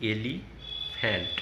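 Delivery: very slow, syllable by syllable; elephant said slowly as e-li-phant, pausing between syllables; broken into syllables. Shown as a demonstration. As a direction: spell out elephant slowly, each syllable apart. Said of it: "এইভাবে ভেঙে ভেঙে".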